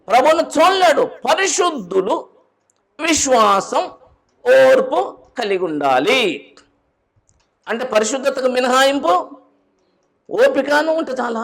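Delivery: high, loud pulpit proclamation; full-force preaching. Acted. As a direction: preach it out to the congregation.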